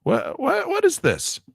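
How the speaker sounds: very high, incredulous cry; can't believe what the program did; real baffled outburst. Real, not acted. Software misbehaves again.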